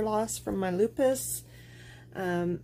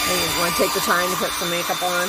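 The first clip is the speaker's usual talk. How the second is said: raised voice over a blow dryer; talking loudly over dryer noise; over hair dryer; 5 dB louder than usual. Real, not acted. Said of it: "so please watch your ears".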